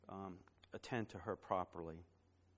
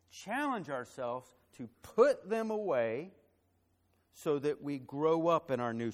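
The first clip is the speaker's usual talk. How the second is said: preaching exhortation; 3 semitones higher, 7 dB louder than usual. Acted. Pastor exhorts the congregation.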